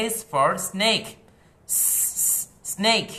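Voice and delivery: snake drawn out, loud and high, with a hissed s; slow phonics demo. Shown as a demonstration. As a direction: stretch snake slowly for the children. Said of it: "ইশারা সহ তোমরা দেখাবে আমার মতো করে". (ধীরে টেনে স্নেক উচ্চারণ)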